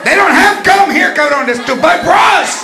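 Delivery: high, loud exasperated mom; grandma yelling at the kid in the store, high and loud; scolding grandma. Acted. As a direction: shout it down at the kid in the aisle.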